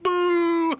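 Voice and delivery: high, loud booing shout. Retold or imitated. Him booing the girl while laughing, loud boo. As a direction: belt out a gloating boo. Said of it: "And I'm like".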